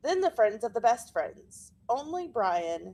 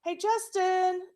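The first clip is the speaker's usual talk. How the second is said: higher call across the playground, drawn out; 8 semitones higher, slower than usual. Acted. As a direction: call his name from across the court. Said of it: "Emilio calls out from the".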